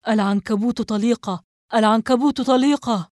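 loud repeated cry, building in force; shouting alarm. A narrator's reading. Investigator raising the alarm over the radio, shouting. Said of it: "وبدأ يصرخ ويردد عبارة".

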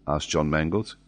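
ordinary delivery

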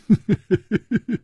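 high-pitched gloating chuckle; acted mischievous laugh; gloating giggle. A narrator's reading. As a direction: giggle in triumph. Boy gloats over his prize.

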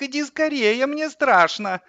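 put-on high, plaintive little boy; whimpering child. A narrator's reading. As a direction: whine for mum to come, frightened. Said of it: "захныкал Славик". (хныкающий испуганный ребёнок)

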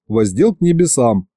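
ordinary delivery, nothing marked

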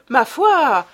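bright, lively exclamation; cheerful young detective. A narrator's reading. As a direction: toss it off gaily. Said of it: "dit Beautrelet gaiement".

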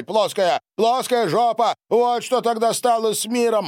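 high, heated outburst; angry insistence. A narrator's reading. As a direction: keep hammering the insult home. Donald's angry quarrel continues. (злой, запальчивый выкрик)

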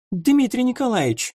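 high squeak through the door; young lady squeaking his name from behind a door. A narrator's reading. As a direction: call out in a thin squeak. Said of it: "пискнула из-за двери мадемуазель Штиглиц".

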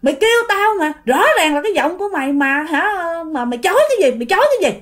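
acted heated scolding; disbelieving uncle. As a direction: press the accusation, refusing to believe. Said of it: "cậu Mơ Tám không tin".

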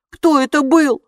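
high, loud scared voice; frightened question. A narrator's reading. Oscar wakes frightened after the bite. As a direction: blurt it out in fright. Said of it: "испуганно спросил он".

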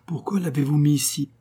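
angry. A narrator's reading. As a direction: ask it with restrained annoyance. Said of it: "dit-il d'un ton fâché".

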